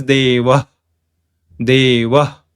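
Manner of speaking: Deva ending in a short puff of breath; Deva, final h with no echoed vowel; ideal visarga pronunciation. Shown as a demonstration. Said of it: "It is pronounced ideally as".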